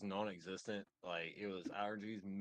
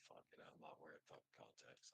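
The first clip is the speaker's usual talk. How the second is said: unvoiced, much softer aside; whispered; 10 semitones lower, 21 dB quieter than usual. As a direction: whisper the thought to himself. Quoting his own thought.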